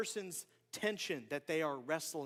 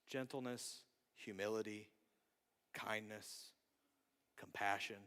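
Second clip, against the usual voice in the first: low, soft, spaced-out words; hushed, solemn; 9 semitones lower, 10 dB quieter than usual. Real, not acted. Preacher listing what we hope others give us, hushed. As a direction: let each virtue land quietly. Solemn answer to own question.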